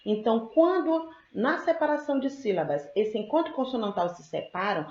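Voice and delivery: usual speaking voice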